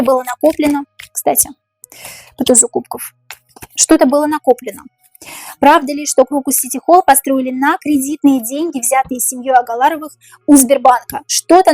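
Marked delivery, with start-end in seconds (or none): none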